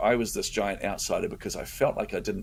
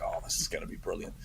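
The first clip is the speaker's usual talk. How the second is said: whispered inner thought; 3 semitones lower, 10 dB quieter than usual. Retold or imitated. Inner thought while waiting for speaker.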